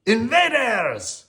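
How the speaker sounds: high, slow stretched French cry; French alarm. A narrator's reading. Lumiere warning of invaders with French accent. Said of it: "cried Lumiere".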